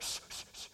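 soft whispered shushing. Retold or imitated. Adult in line shushing the others, whispered. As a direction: hush everyone quickly. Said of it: "You're like".

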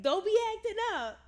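high, loud mock scolding; acted scolding. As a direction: retell her telling him off, voice way up. Acted scolding on video call.

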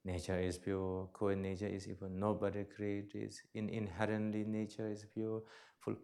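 low, soft chant of a mantra; repeated mantra. Shown as a demonstration. Teacher demonstrating a mantra to the audience, low soft chant. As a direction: murmur the phrase like a meditation mantra. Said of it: "Then you can repeat".

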